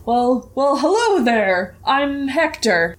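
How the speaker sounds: slick wagon trader, drawn-out greeting; welcoming salesman, theatrical and slow; showy merchant voice. Acted. Merchant Hector greets travellers.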